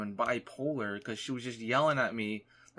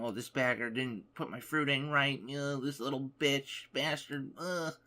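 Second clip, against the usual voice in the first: higher mock old-lady grumble; muttering insults in a lightly voiced granny; grumbling old lady; 3 semitones higher than usual. Old lady badmouthing the bagger behind him, mock grumble. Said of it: "still talking shit".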